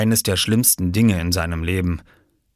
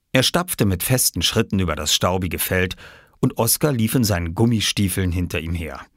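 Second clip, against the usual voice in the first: ordinary delivery, nothing marked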